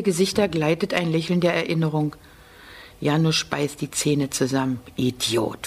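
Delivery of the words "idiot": low, hissed "Idiot" under the breath; wütend geflüstert; angry whisper. A narrator's reading. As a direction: spit it out so only he hears. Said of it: "flüstert er".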